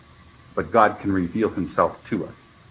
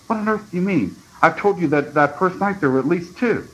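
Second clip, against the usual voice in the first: put-on high-pitched, incredulous boy; astonished objection, pitched well up; indignant disbelief; 9 semitones higher than usual. A narrator's reading. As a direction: protest in a raised, baffled voice.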